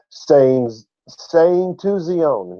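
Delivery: ZION stretched out slowly; emphatic reading. Real, not acted. Teacher reading scripture to the class, emphatic. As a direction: draw out "saying to Zion", very slow.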